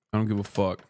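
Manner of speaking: ordinary delivery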